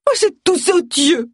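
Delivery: high-pitched, outraged exclamation; indignant outburst. Acted.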